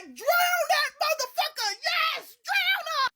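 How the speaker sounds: high, loud cheering yell; excited shout. Retold or imitated. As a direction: egg them on with a wild shout. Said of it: "I'm sitting in the back like".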